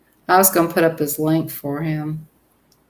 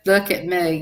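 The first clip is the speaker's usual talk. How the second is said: usual speaking voice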